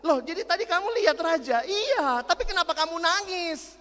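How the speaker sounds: put-on teacher confused by the girl's tears; puzzled teacher. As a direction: press her for the reason. Teacher questioning crying girl.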